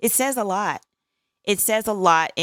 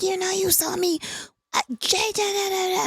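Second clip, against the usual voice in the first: high-pitched imitation of yelling; mock crazy yelling. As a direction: imitate yourself ranting at your son, pitched way up. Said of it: "I start yelling at my son like a crazy woman".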